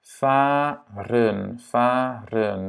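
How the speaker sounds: fahren slowly, in two drawn-out syllables; long first vowel. Shown as a demonstration. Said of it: "la première voyelle est longue". (« fahren » lentement, premier A long)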